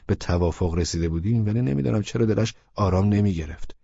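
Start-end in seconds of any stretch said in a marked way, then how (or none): none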